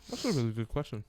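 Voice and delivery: talking with a mouthful; mouth full. Real, not acted. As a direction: speak around a mouthful of food. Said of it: "Talking with a mouthful".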